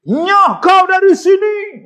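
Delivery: high, loud furious roar at the intruder; slow, drawn-out hammed-up ghostly yell; angry spirit shout. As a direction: bellow like an offended spirit driving you out. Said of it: "bila nampak, marah".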